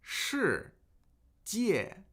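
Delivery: 世 then 界 said apart, each one falling; syllable by syllable. Shown as a demonstration. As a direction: split 世界 slowly into two falling tones. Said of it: "Two fourth tones".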